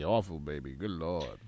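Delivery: raspy allergy voice. Real, not acted. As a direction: croak it out through sore allergies. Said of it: "non-raspy voice back hopefully next week".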